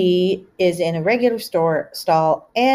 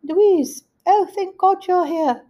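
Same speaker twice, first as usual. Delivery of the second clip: put-on higher, slower voice of a stuck old mother; elderly mom's voice; 13 semitones higher, 3 dB louder, slower than usual. Mother stuck on the toilet answering her daughter, relieved. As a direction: play the relieved, helpless mom, slowly. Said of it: "And she said".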